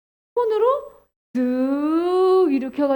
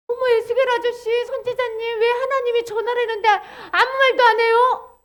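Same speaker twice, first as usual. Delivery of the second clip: high, even, singsong questioning; puzzled onlooker; 7 semitones higher than usual. Acted. Someone asking Ezekiel why he stays silent, high puzzled voice. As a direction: pipe up high in surprise.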